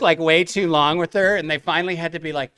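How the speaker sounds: ordinary talk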